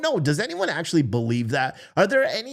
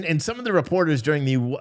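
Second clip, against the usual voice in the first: ordinary talk, nothing marked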